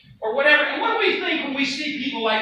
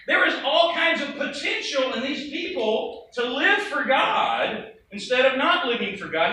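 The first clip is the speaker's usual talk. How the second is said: ordinary talk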